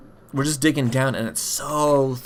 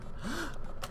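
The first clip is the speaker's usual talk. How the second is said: high gasping breath; gasp for air; 13 dB quieter than usual. Pretend. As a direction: suck in air like surfacing. Surfacing for air in game.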